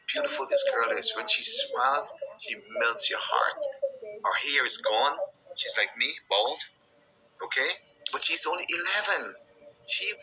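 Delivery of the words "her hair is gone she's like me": ordinary delivery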